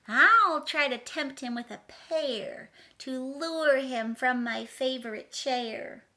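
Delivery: high, loud, sing-song rhyming read; dramatic storybook reading. A narrator's reading. Reading the picture book to children, dramatically. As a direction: read the rhyme big for kids.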